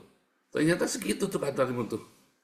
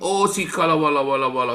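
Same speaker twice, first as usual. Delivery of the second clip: higher, louder string of babbled syllables; mock babbled prayer; 3 semitones higher, 7 dB louder than usual. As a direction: babble loudly in imitation of fervent prayer. Menirukan orang bicara sembarang suku kata. (tiruan doa meracau)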